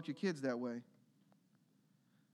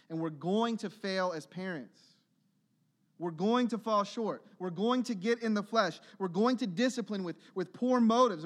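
rising, louder preacher's exhortation; fervent preaching; 5 semitones higher, 5 dB louder than usual. Real, not acted. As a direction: press the congregation hard, building. Preaching builds to full force.

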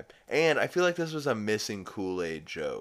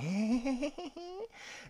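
high, small giggle; sheepish giggle; 4 dB quieter than usual. Retold or imitated. Lois caught with the judge's gavel, sheepish giggle. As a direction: imitate a sheepish little titter. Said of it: "she laughs sheepishly".

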